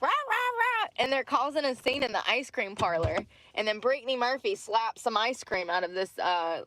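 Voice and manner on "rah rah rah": high-pitched mock barks; imitated barking. Girls barking at a woman in the ice cream parlor, mock barking. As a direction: bark like jeering girls, pitched way up. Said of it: "start barking at this woman. They're like".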